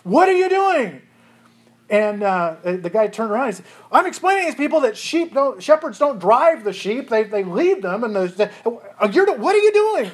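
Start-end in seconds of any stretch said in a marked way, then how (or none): 0.0-1.0 high, loud furious shout; furious yell. Retold or imitated. Tour guide yelling at the rock-throwing local, furious. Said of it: "he started yelling at the guy". 3.9-10.1 angry yelling. Retold or imitated. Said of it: "he started yelling at the guy".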